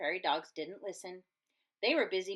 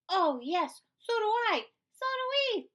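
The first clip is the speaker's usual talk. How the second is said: excited high little critter; eager high voice; 12 semitones higher, 4 dB louder than usual. Acted. Prairie dogs wanting fuzz, high and eager. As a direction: squeal it out, wanting some.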